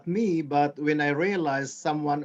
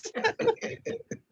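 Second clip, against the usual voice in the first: high laugh; 5 dB quieter than usual. Real, not acted. Laughs about bingo number.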